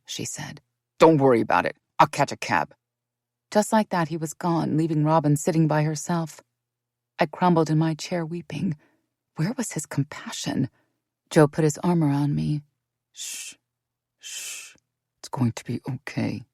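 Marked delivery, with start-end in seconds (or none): 1.0-2.8 low, louder, cold male voice; David refuses to wait for Robin; curt dismissal. A narrator's reading. 13.1-16.5 soft, low, whispered shushing; calm her gently; soothing hush. A narrator's reading. Joe comforting narrator.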